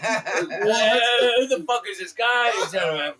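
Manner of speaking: high, loud, befuddled rocker impression. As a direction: holler to the wife like a confused British rock star. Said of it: "if he ever actually met [name]".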